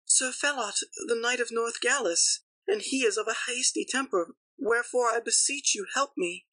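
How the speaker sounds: very high-pitched lady's voice; pleading fair lady. A narrator's reading. Lady names her husband to Launcelot, high pleading voice. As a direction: beseech the knight in a high lady's voice.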